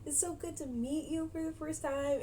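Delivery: put-on boyfriend's mother greeting the girlfriend sweetly; mock sweet mother. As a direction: greet her like a polite mom. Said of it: "This woman's like".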